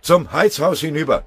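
high, very loud urgent shout; urging. A narrator's reading. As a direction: yell to drive the men onward. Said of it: "drängte Pfennigbauer". (laut drängender Ruf)

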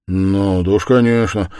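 curt. A narrator's reading. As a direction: cut the answer short. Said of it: "произнёс он отрывисто". (отрывисто)

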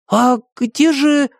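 high, slow puzzled question. A narrator's reading. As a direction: wonder where he went. Tim searching fridge.

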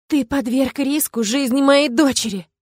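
near-tears shout; voice breaking. A narrator's reading. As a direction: yell as the voice breaks. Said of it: "кричу я срывающимся голосом".

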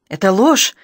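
loud angry denial; shouting. Acted. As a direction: fling the lie back at him, loud. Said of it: "закричала она". (громкий гневный крик)

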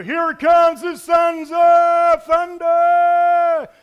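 announcing a tag team entrance, slow and huge; wrestling announcer. Acted. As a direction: bellow it like a WWF ring announcer hyping the crowd. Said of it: "a great act for W W F".

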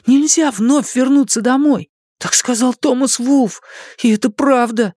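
put-on high, scared child voice; a scared boy insisting; frightened boy. Jake frightened continues.

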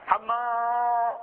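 long drawn-out high call of the name; drawn-out village call. Shown as a demonstration. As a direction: call him from across the village, stretched. Calling a man by name.

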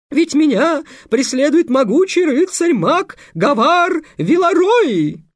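very high, loud melodramatic falsetto; boy playing a girl in falsetto. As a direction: overact a princess in falsetto. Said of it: "срывающимся фальцетом". (патетичный фальцет, парень играет девушку)

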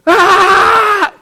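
grito agudo y sostenido, muy fuerte; grito de espanto. Acted. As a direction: grita a todo pulmón, aterrado en broma. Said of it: "les entra esa inquietud, dicen". (alarido actuado de discípulos asustados)

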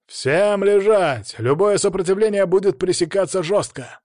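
deafening order over a megaphone; loudspeaker command. Acted. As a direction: bark it through a loudspeaker. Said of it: "Оглушительный голос по громкоговорителю".

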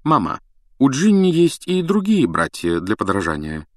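superior, self-satisfied remark; haughty. A narrator's reading. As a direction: look down on your brothers as you speak. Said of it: "надменно молвил Перси".

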